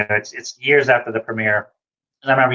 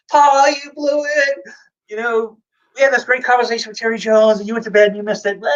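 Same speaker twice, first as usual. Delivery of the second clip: mock gloating; 11 semitones higher than usual. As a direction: rub it in that he slept through it. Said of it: "I was like".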